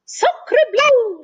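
a duck amazed at the nest; excited cry. Acted. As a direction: blurt it out in surprise. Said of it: "she cried".

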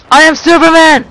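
loud boastful cheer; triumphant. Real, not acted. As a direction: crow in triumph.

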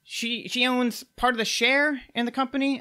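the little gnome checking his facts with Maddox; gnome aside to Maddox. Acted. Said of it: "is like".

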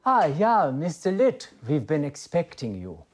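put-on bright German-accented greeting, high and loud. Therapist welcoming a new patient, high, loud German accent. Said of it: "did you just speak in a German accent".

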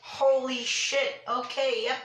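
drawn-out stunned curse; shocked, slow exclamation. Real, not acted. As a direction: let it out slowly, still shaken. Reacting to the scare.

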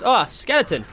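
ordinary delivery

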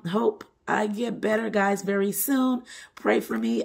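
usual speaking voice

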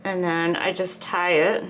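talking around a needle; mouth full. Real, not acted. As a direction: needle held between the lips. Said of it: "There's the needle in my mouth again".

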